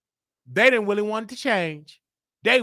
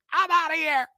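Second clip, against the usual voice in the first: dismissive exit; 12 semitones higher than usual. Retold or imitated. Quoting himself leaving.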